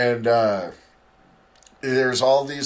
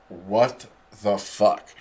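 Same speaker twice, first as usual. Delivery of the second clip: slow, drawn-out what the fuck; stunned into a slow mock exclamation; slow stunned disbelief; 2 semitones lower, much slower than usual. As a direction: let the shock stretch each word out. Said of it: "and I'm just like".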